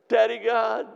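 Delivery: put-on high, loud childlike call of praise; Marge. As Marge when the praise began, eager high call. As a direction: imitate an eager worshipper crying out.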